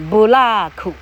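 布拉库 as three even Japanese syllables, bu-ra-ku; 布拉库 with a Japanese accent; Japanese-style English. Shown as a demonstration. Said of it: "大概是这样哈".

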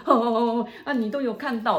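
imitating her shock that he saw it; a mock frightened outburst; retold startled fright. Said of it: "他讲说".

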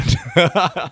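high bright burst of laughter; real laugh. Real, not acted. Speaker laughing at own story to the host, real laugh.

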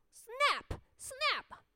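high, sharp snapping call; snapping sound word. A narrator's reading. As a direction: bite it out sharply. Fox snaps him up.

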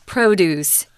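produce as PRO-duce, strong PROH then lighter doos; stress on first syllable. Shown as a demonstration. Teacher modelling the noun for learners, produce stressed on PRO. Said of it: "the stronger syllable is on pro".

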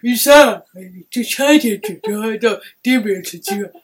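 put-on shaky old man voice, lightly done. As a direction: imitate an old teacher, half laughing. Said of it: "你刚刚不是老头的声音，请你再讲一次". (轻轻模仿老头的声音)

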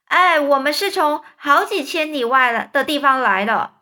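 loud happy reply; loud answer. A narrator's reading. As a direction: answer loudly. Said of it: "一边大声回答".

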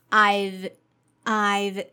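I've with a v, no h. Shown as a demonstration. Teacher modeling I've for students, crisp. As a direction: say I've as one quick syllable. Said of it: "let's try it again".

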